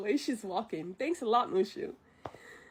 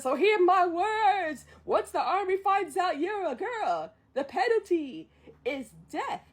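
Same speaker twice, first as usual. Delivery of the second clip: Mushu's booming warning; 6 semitones higher than usual. Retold or imitated. Acts Mushu's grand warning.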